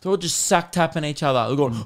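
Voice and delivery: ordinary talk, nothing marked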